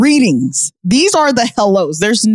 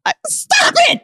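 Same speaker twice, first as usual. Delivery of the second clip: high shouted stop it; squealing objection; shouted protest. Real, not acted. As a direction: yelp at the teasing. Protesting cohost's teasing.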